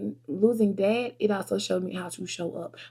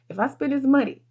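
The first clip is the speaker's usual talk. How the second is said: sighing repeat; 6 semitones higher, 7 dB louder than usual. Real, not acted. Repeats the phrase with a sigh.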